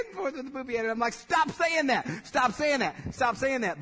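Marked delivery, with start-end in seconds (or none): 1.1-3.8 loud, high, repeated scolding; exasperated parent. Retold or imitated. Parent telling the kids to stop the name-calling, loud exasperated scolding. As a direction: order the kids to quit it, exasperated.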